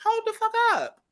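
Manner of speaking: high-pitched hold up, mock alarm; imitating a high shocked voice; high yelped quote. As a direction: squeak it out, stopping a friend. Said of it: "I'm like".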